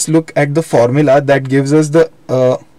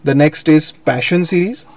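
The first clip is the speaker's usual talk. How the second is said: ordinary delivery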